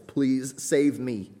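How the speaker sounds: slow, earnest please save me. Retold or imitated. Honest prayer to Christ, slow earnest plea. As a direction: plead it slowly and sincerely. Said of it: "the most honest thing you can ever say to Christ is".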